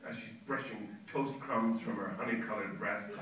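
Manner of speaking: ordinary delivery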